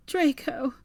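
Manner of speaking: sobbing loudly. A narrator's reading. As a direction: sob his name. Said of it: "she sobbed loudly".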